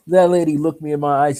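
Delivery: plain reading voice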